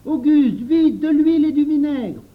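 very loud, high call; high, forceful holler; urgent shout. Acted. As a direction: shout at him to hurry. Henri urgently calls helper.

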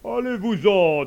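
put-on loud, slow, angry old man; irritated pope ordering him away. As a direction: drive the devil off, booming. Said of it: "d'un air fâché".

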